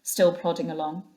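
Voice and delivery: usual speaking voice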